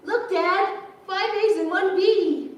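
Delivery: put-on high, slow excited child voice; proud little girl. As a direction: show off the report card like a kid. Flashback, Dad and Sharon.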